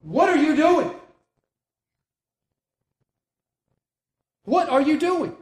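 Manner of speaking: high, loud, exasperated protest; breaking under pressure. Acted. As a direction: burst out at pleading friends, fed up. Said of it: "He finally breaks. Then Paul answered".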